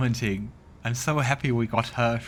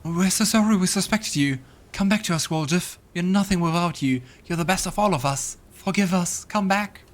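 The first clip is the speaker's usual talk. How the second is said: higher, louder begging chorus; pleading vision voices; 8 semitones higher, 4 dB louder than usual. Acted. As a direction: plead for him to come back.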